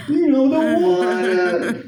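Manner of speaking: high, hammed-up Brooklyn Puerto Rican accent. Sweaty Brooklyn coworker begging for water, high mock accent. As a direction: squeal it out like a thirsty New Yorker. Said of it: "He's talking that way".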